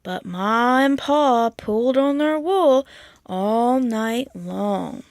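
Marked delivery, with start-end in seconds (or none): 3.3-5.0 drawn out slowly, storybook ending; slow refrain. A narrator's reading. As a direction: stretch it out like a lullaby.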